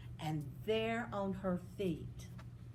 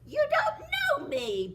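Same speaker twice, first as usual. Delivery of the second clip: high, louder fairy voice; lightly voiced sweet fairy; fairy godmother; 7 dB louder than usual. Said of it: "she said".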